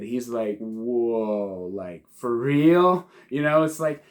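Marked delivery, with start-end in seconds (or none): 0.6-3.1 slow, drawn-out hint of delight; a touch of eager wonder, stretched out; mock elated. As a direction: play it lightly thrilled at the news of battle. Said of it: "His eyes light up like he's elated".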